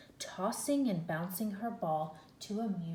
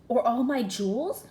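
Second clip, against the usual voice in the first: offering; 4 semitones higher, 9 dB louder than usual. A narrator's reading. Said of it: "she offered".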